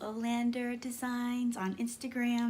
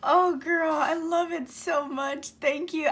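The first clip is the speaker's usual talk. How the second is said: loud, gushing thanks; loud, thrilled gushing; excited gushing; 4 semitones higher, 9 dB louder than usual. Real, not acted. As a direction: gush with real delight. Gushing over the gift.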